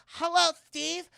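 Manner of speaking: high, slow, loud sultry greeting; seductive woman. Acted. Doctor Wendy greets Steve to woo him, sultry high voice. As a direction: purr it out as a flirty lady doctor. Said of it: "And she's like".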